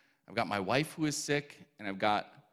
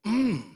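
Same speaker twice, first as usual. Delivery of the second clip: loud closed-mouth groan; imitating a stomach-ache moan; 10 semitones higher, 7 dB louder than usual. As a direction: hum out a pained groan. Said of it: "groaning, like".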